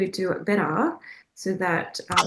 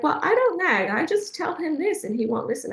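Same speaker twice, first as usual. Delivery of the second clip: higher-pitched imitation of a protesting mum; imitated defensive mother, pitched up; mock protesting; 8 semitones higher than usual. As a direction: protest innocence in a raised pitch. Mum protests she doesn't nag.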